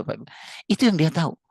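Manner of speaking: ordinary talk, nothing marked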